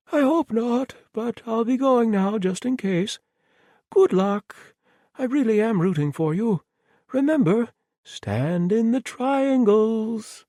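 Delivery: breezy murderer, perfectly reasonable; cheerful mad villain. Acted. Thomas voiced as cheerful madman.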